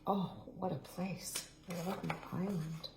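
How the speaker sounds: soft, quick murmured comment; hushed aside. Acted. Reader murmurs to the children in a soft aside. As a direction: drop to a near-hush, half to yourself.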